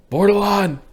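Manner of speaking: high, loud hail; calling out. Pretend. Summoning a friend into the roleplay, loud call. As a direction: holler the name across to him.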